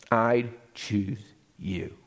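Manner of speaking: very slow, deliberate and loving; tender declaration. Acted. Mother elephant revealing her choice to her child, tender and slow. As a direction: draw out each word as a heartfelt gift.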